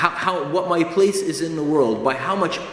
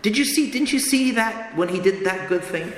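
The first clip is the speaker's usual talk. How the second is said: a lightly voiced, raised inner voice nudging you; lightly voiced conscience coaxing you to see the good; 5 semitones higher than usual. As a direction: play the small voice of conscience pleading, a bit higher. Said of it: "The little voice will say".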